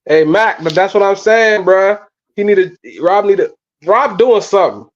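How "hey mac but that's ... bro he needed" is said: ordinary delivery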